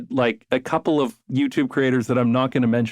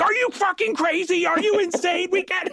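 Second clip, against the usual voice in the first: much higher, shrill-sounding mock outrage, imitating; mock outraged North American. Urbanist imitates North Americans reacting to car-free centres, in much higher mock outrage. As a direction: squeal it like a scandalised driver, far higher pitch. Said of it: "they'll be like".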